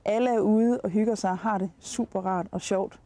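normal talking voice

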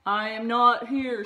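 loud, stubborn refusal; stubborn Toad. A narrator's reading. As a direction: insist you're gone. Toad answers from bed.

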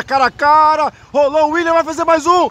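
high, fast goal call; excited goal call. Real, not acted. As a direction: climb as he rolls it in. One-on-one then goal. (narração de gol empolgada)

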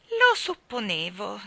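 slow, smug and knowing; superior. A narrator's reading. Mary Poppins to the children, superior and smug. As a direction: savour it, sure you were right. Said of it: "disse con aria di superiorità".

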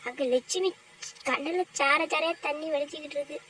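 normal talking voice

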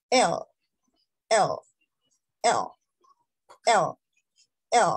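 letter "L" as a dark English "el"; soft English L. Shown as a demonstration. Teacher models English letter L, soft dark L. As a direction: let the l of "L" fall softly back. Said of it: "pero suave".

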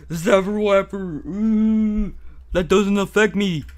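high, loud zap call and boast; loud pretend battle boast; defiant play taunt. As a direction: shout it high, shrugging off the zap. Second zap, louder defiant taunt.

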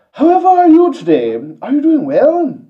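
put-on very high, loud posh English accent like Stewie; Englishman. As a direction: greet them in a shrill cartoon English accent. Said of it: "let me try my Englishman".

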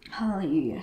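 a loud, ringing hallelujah; loud joyful cry; cried out hallelujah. A narrator's reading. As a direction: call it out like a crowd in praise. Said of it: "crying out".

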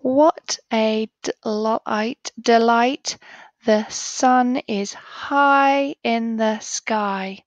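slow, stretched read-along pace; teacher reading with the class in unison; slow choral reading. Shown as a demonstration. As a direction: lead the class reading together, slowly. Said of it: "let's read it all together".

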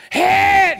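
very high, loud impatient shout; retold shout. Impatient old golfer shouting at his young partner, very loud. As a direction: bark it like a command on the tee. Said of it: "He'd get this look on his face, like".

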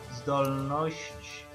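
ordinary talk, nothing marked

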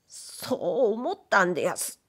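ordinary delivery, nothing marked

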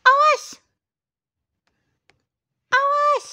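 high, loud cat voice; warning cat. Pretend. Cat threatens the baby, high loud cat voice. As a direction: bark a warning as a little cat.

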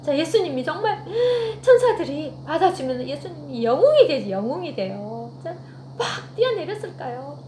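ordinary talk, nothing marked

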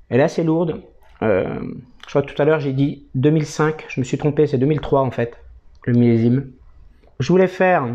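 ordinary talk

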